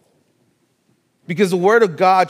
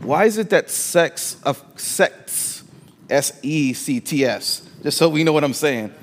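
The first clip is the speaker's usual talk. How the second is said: ordinary talk, nothing marked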